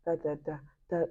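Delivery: ordinary delivery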